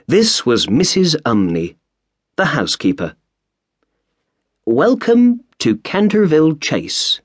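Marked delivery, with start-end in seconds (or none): 4.6-7.2 high, formal greeting at the door; housekeeper's welcome. A narrator's reading. Housekeeper welcoming the arriving family, high and formal. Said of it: "she said".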